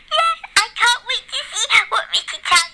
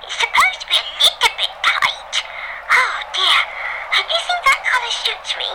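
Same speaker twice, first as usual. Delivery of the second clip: high wife voice; 8 semitones higher than usual. Acted. [name] replies.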